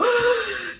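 sharp high gasp of shock. Retold or imitated. On seeing her dad's number, a shocked high gasp. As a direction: gasp as if the breath is stolen.

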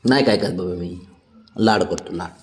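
telling the pet he is not being scolded; reassuring. Real, not acted. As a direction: back off and comfort the pet gently. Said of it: "नाही ओरडत नाही तुला".

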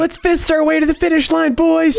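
high, fast excited shout; shrieking jackpot winner. Real, not acted. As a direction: cheer like you hit the jackpot. Round win celebration.

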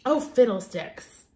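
ordinary delivery, nothing marked

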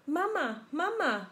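egg calling mama. Acted.

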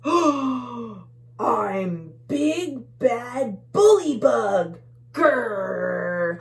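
slow, loud menacing bug growl; bully bug. Acted. Bully bug announcing himself to the tiny bugs, slow and growly. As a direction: drag it out like a monster.

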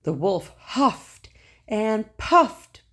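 slow, breathy huffing and puffing. A narrator's reading. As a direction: puff out the words hard. Narrator acts out huffing.

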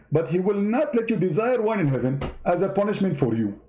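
usual speaking voice

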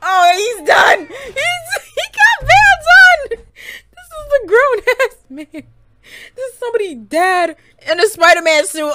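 high, loud, wailing disbelief; squealing, loud amusement; hysterical outburst. Real, not acted. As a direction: shriek it on the edge of laughter. Losing it over costume clip.

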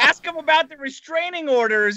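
eager heckle. Real, not acted. Guest urging host to grill Maddox, gleeful and loud. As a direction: egg the host on with glee.

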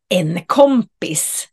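ordinary delivery

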